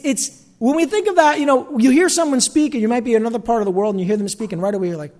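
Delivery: ordinary delivery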